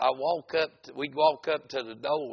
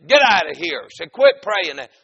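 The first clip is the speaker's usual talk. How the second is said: loud, higher angry shout; retold hollering; 6 semitones higher, 14 dB louder than usual. Man interrupting the preacher's prayer, hollering. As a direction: yell to stop the prayer. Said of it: "he started hollering while I was praying".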